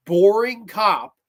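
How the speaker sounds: slow, scornful stress on "boring cop"; dragged emphasis. Real, not acted. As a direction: stretch "boring" out in disgust. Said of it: "has the personality of a piece of fucking Wonder Bread".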